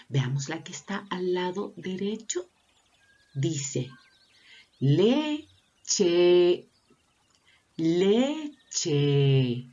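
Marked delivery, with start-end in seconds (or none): 4.8-9.7 "leche" in two long beats, le and cheee; sounded out syllables. Shown as a demonstration. Teacher asking which vowel ends it, drawn-out "leche". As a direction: split "leche" and hold the final E. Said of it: "¿Con qué vocal habrá terminado?".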